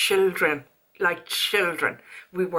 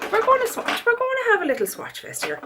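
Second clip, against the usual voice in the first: high, quick and bright; upbeat swatching announcement; excited; 13 semitones higher than usual. Real, not acted. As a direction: sell the swatch fest with glee.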